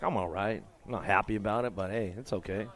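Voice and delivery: mock rider voice. Announcer voicing a beaten rider, low and quiet. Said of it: "He's like".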